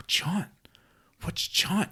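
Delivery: put-on high-pitched little boy, baffled; high confused kid. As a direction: squeak it like a puzzled kid. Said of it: "he was like".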